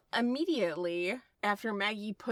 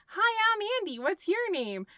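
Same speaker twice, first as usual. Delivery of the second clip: put-on excited little boy; 12 semitones higher, 3 dB louder than usual. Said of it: "And he says".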